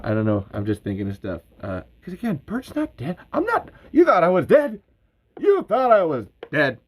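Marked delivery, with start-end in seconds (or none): 1.6-3.7 much higher, insisting he's alive; indignant Bert Gummer. Retold or imitated. Bert protests his death rumors, pitched way up in protest. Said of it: "like, Southern for Bert". 3.9-6.8 much higher and louder, punching YOU; triumphant shout. Retold or imitated. Bert gloats to viewers who thought him dead, triumphant shout. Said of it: "like, Southern for Bert".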